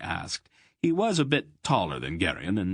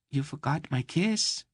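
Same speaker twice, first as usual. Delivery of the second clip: Mady reminding Garion of his kiss; coy girl; 8 semitones higher than usual. Acted. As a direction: remind him sweetly. Narrator voices Mady.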